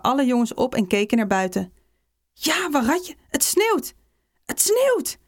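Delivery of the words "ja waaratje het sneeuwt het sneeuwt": high, rising excitement of schoolchildren; excited outcry. A narrator's reading. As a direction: cry it higher each time, thrilled by snow. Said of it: "hoorde je door de hele klas". (opgewonden, steeds hogere kinderkreten)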